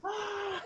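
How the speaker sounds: high, soft held cry of pain; a long thin yelp up high; hammed-up hurt yelp; mock cry. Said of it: "then Brandon Fraser goes".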